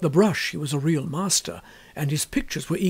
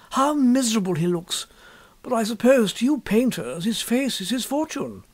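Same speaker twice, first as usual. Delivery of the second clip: put-on a young gentleman's gentle pity, raised; lightly voiced Hughie; 5 semitones higher than usual. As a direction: sound sorry for the old man. Hughie pitying the beggar.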